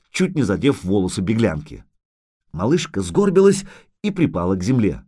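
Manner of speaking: usual speaking voice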